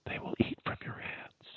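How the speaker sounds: soft voice dropping into whisper. Shown as a demonstration. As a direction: draw listeners in with a whisper. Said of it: "when you speak in a whisper".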